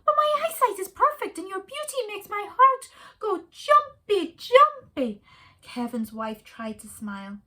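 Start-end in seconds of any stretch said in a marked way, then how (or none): 0.0-5.2 high, loud squeaky meerkat; a chirpy little meerkat answering his love. A narrator's reading. Meerkat Kevin to his wife, high and eager.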